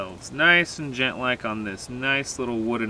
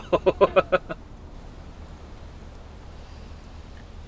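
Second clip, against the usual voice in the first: breathless laughter; 6 semitones higher, 7 dB quieter than usual. Real, not acted. Laughs after falling.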